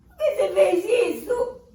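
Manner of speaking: high, loud, tearful protest; upset child. Acted. Boy cries out at his father for tearing the ghost. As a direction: cry out at the loss.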